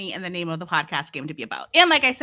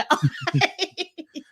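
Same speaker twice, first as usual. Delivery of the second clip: laugh; 9 semitones higher than usual. Real, not acted.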